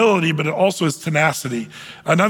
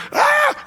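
sudden high shouted "Ah!"; wild yell. Retold or imitated. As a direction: let out a frantic cry for help. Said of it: "he's yelling out with a great vehement".

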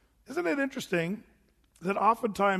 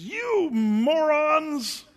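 slow, high, loud drawn-out insult; mock yell; 9 semitones higher, 5 dB louder, much slower than usual. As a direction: stretch it out like an exasperated shout. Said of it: "That's what he called them".

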